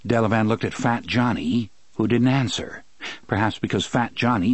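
usual speaking voice

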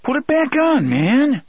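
high, urgent outburst; agitated protest. Acted. Eddie refusing Roland's gun belt, agitated.

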